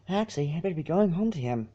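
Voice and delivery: soft, quick Fluttershy, a little worried; tinged with worry. A narrator's reading. Fluttershy tells friends she must go home, soft and worried. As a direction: hurry it softly, anxious to leave. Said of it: "her voice tinged with worry".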